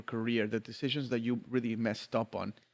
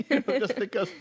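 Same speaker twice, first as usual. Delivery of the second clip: giggles through the words, pitched up; laughing while talking; 6 semitones higher, 3 dB louder than usual. Real, not acted. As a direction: laugh it out, half embarrassed. Admitting he likes drinking on shoots.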